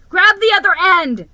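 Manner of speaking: high, loud urgent command; urgent shout. A narrator's reading. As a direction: shout it so he hears. Rescue from icy water.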